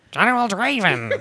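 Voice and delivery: high, put-on officer voice; mustache rebel officer. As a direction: announce it like a pompous officer. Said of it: "who's just like".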